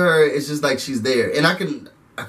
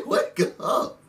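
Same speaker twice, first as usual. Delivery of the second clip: whispered wake up; 3 semitones higher, 6 dB quieter than usual. Retold or imitated. Quoting a wake-up call.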